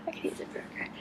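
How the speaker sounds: breathy whisper under the breath; whispered aside. Real, not acted. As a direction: mutter it to yourself, barely audible. Hungry reader's whispered aside.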